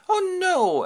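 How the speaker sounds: very high, loud wail; nymph's dismay. Acted. Calypso hears Hermes' order, high wail of dismay. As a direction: wail it in a high female caricature.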